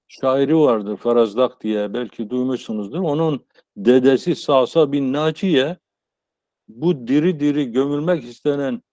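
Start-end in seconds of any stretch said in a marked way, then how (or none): none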